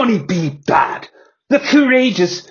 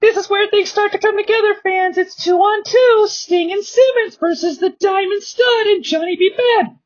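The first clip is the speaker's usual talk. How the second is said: much higher, fast announcer talk; high commentator voice. Acted. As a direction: hype up the tag match. Commentator sums up the match.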